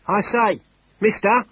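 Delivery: high, loud panicked call; agitated cry. Acted. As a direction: cry out in shock. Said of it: "with a second cry of astonishment".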